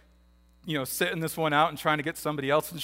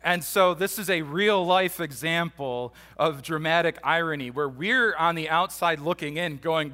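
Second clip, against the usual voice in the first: ordinary talk, nothing marked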